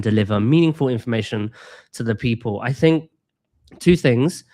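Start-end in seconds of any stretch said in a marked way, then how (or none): none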